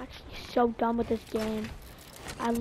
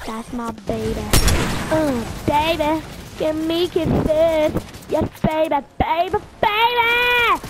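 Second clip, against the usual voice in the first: louder, slow doting coo to a beloved gun; doting baby talk; 8 dB louder than usual. Pretend. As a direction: gush over the gun like a baby. Baby-talking to his weapon.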